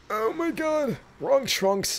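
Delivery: high-pitched exasperated outburst. Real, not acted. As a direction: cry out at the wrong pull. Exasperated at a duplicate pull.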